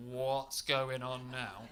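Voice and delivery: drawn-out, baffled question; stunned slow thought; slow bewilderment. Retold or imitated. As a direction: stretch each word in disbelief. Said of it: "And I was like".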